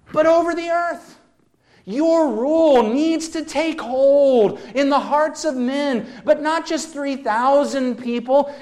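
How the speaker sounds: fervent sermon climax; preaching at full force. Acted. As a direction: preach it out with mounting passion.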